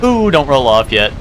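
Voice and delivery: ordinary talk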